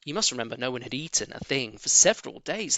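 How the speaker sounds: plain reading voice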